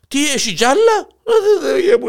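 mock sobbing. Grandpa cries over the story.

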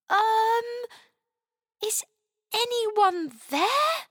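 high, slow, hesitant question; tentative little girl voice; tentative call. A narrator's reading. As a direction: call cautiously into the unknown, pitched high. Chloe asks into the bin.

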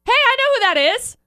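high, loud call of recognition; hammed-up eureka moment; excited recognition. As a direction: call out as if you just spotted someone. Acting viewer spotting the fugitive.

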